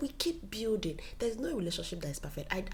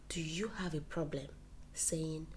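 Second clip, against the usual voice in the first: ordinary talk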